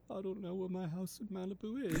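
put-on high, soft, laughing sad old man. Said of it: "sad old man voice comes out".

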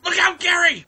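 high, loud warning; alarmed shout. Acted. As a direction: yell a warning. Cow warns the other.

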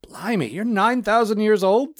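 high, loud, slow disbelief; astonished. A narrator's reading. Astonished postman voiced in story.

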